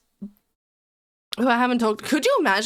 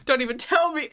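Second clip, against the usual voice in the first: ordinary talk, nothing marked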